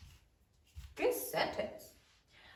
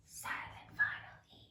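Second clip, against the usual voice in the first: hushed whisper for a silent letter; 13 dB quieter than usual. Shown as a demonstration. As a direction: whisper it as an aside.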